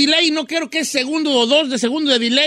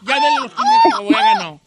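excited squeal. Real, not acted. Host celebrating the caller winning five hundred, excited high cheer. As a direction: cheer her win like a game-show host.

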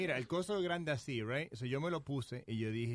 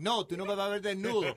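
higher, louder mock refusal; retold protest; 6 semitones higher, 5 dB louder than usual. Guest retells refusing his friend, raised protesting voice. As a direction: object firmly, replaying the argument.

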